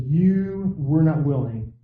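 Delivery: "You" leaned on, then "were not willing" slowly falling; slow, weighty quote. Acted. Preacher quoting Jesus to the crowd, slow and weighty. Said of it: "But he says".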